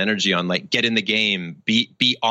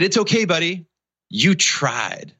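consoling dad; 8 semitones higher than usual. Retold or imitated. Dad consoling son on wall.

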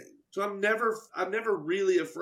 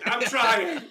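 high, loud plea of exasperation; comic outburst; mock frustrated protest; 6 semitones higher, 6 dB louder than usual. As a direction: yelp it, hammed-up and flustered. Answers back as the flustered heroine.

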